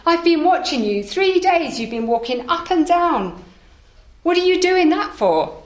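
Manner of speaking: a curious merchant hailing a stranger; lightly voiced shopkeeper.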